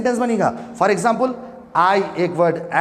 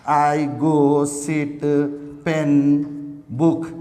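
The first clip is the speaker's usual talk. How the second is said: slow dictation; 6 semitones lower, much slower than usual. Shown as a demonstration. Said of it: "चलिए क्या ये sentence हुआ?".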